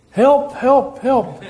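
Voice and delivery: climber clinging on, hammed-up panic; desperate cry for help. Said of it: "And he said".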